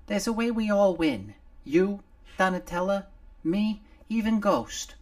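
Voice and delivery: a little face on a shoulder gulping fresh air; little Nomar. Acted. Nomar freed from under the clothing, high and loud.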